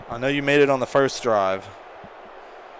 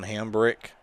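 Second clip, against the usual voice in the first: ordinary delivery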